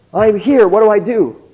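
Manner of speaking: mock a lost man begging for help; pained plea. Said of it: "he gave me this sort of pained expression".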